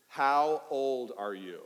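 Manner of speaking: slow drawn-out question; slow call to kids. Shown as a demonstration. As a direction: draw the question out as a cue. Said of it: "I'm gonna say".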